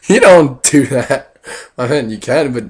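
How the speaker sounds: loud, slow, shocked protest. Retold or imitated. Vlogger reacting to coworker's advice, loud slow shock. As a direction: recoil and spell it out slowly. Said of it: "And I'm like".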